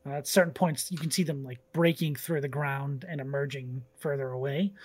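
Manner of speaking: lightly voiced scene-setting narration; game master narration. A narrator's reading. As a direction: paint the jungle calmly for players.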